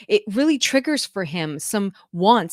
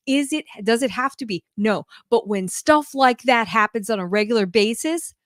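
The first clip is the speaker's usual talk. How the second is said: usual speaking voice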